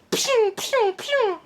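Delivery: squeaky high pew noises; high pew pew sound effects. Retold or imitated. Retelling the movie fight, a high mock zap noise. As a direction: play a cartoon zap sound. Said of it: "It's more like".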